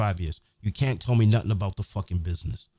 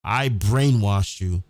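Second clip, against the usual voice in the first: slow mock epitaph; 3 dB louder, much slower than usual. Mock epitaph line repeated slowly.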